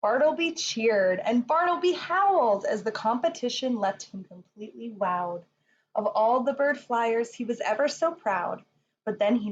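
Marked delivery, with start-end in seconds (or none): none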